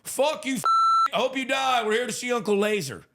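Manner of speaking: put-on high, angry drunk heckler; hammed-up furious heckler cry; mock heckler yell. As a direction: snarl it up high like a rowdy drunk. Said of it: "the one guy in the crowd goes".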